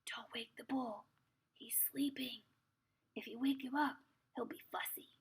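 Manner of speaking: very soft, hushed warning; whisper. Acted. Pippi warns Anika about the sleeping bull, whispering. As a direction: whisper carefully so the sleeper won't wake. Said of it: "whispered Pippi".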